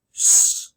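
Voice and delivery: a long unvoiced "sh"; sh sound. Shown as a demonstration. Teacher shows pupils the digraph sound, a long "sh". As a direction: push out the "sh" as a held hiss. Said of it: "The S H makes one sound".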